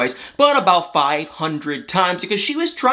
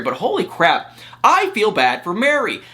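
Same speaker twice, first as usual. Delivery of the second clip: ordinary talk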